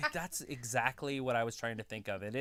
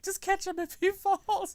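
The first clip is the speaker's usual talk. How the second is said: laughing; 6 dB louder than usual. Retold or imitated.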